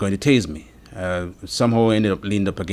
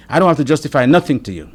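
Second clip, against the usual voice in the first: retold officer's retort, louder, higher and fast; imitating defiant refusal; 7 semitones higher, 8 dB louder, faster than usual. Officer refuses to justify the stop, defiant retort. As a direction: quote the officer firmly refusing to explain.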